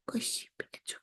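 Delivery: soft whisper to the pet; gentle whisper. Real, not acted. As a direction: hush it so she stays asleep.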